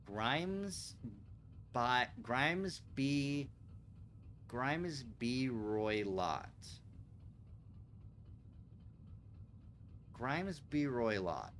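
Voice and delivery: "Grimes B Roylott" drawn out syllable by syllable; sounding out slowly. Shown as a demonstration. Detective puzzling over the strange name, slow sounding out. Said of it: "What could that be?".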